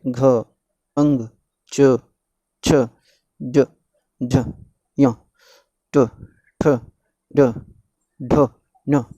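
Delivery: each letter from घ to ह as a short isolated half sound; halant consonants. Shown as a demonstration. Teacher reciting the half letters, each short, from घ to ह. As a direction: recite घ, च, छ and the rest one by one, vowel cut short. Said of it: "हलंत अक्षर पढ़ते समय ये आवाज़ नहीं आनी चाहिए".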